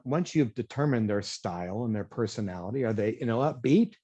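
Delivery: normal talking voice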